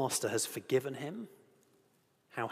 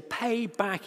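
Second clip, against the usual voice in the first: angry demand; 7 semitones higher, 3 dB louder than usual. Acted. Servant choking his fellow servant.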